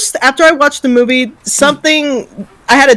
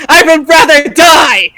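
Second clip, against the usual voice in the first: defiant shout; 5 semitones higher, 3 dB louder, slower than usual. Acted. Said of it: "And the Kong's like".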